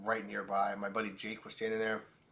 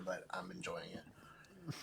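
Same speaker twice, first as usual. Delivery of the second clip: slow guilty whisper; 3 semitones lower, 14 dB quieter, much slower than usual. Real, not acted. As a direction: whisper it like a confession. Confessing being a hater.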